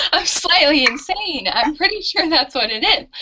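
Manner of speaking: half-laughing as she confesses she's a little crazy. Real, not acted. A guest laughs off her grit as being slightly insane.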